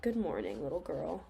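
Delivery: low gruff big-bad-wolf voice; deep, rough wolf voice. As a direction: growl it out like a wolf greeting a girl. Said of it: "The wolf spoke to her in a gruff voice".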